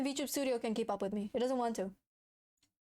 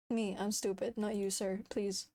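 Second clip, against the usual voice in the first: ordinary talk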